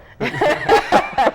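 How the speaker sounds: loud, high burst of laughter; big amused cackle; real laughter. Real, not acted. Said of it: "but I laugh because".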